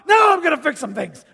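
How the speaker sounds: hammed-up angry father yelling; blowing up. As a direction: explode like a dad who's had enough. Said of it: "the dad who blows up".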